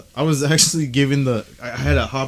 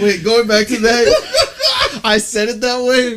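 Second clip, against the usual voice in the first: laughing; 8 semitones higher, 4 dB louder, much slower than usual. Real, not acted. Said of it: "laughing while recalling".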